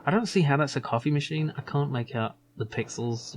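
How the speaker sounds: ordinary talk, nothing marked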